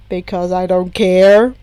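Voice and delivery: easy retort. Acted. Toya brushing off Tenko with a careless retort. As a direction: toss it off like it's nothing. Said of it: "Toya retorted easily".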